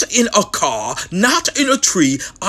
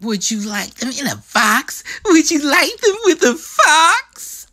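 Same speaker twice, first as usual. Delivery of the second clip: eager Sam; 7 semitones higher than usual. Acted. Sam badgering the grumpy one, eager and rising. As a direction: press the offer, climbing each time.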